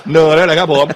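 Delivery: loud, hammed-up Phetchaburi twang; mock Phetchaburi accent. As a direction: tease back in a broad Phetchaburi accent. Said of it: "ทำไมเสียงเหน่อเหลือเกินฮะ".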